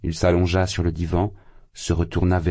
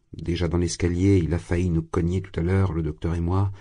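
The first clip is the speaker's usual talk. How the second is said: plain reading voice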